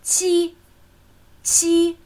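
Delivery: "qi" held high and perfectly level; high, even tone. Shown as a demonstration. Teacher demonstrating the number seven, high even tone. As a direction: keep each "qi" up high without moving the pitch. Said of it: "Erste hohe, gleichmäßige Ton".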